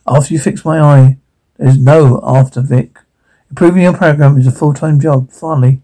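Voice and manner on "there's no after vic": plain reading voice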